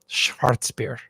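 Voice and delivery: lightly attempted German accent; poor German accent. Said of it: "in a probably pretty poor accent".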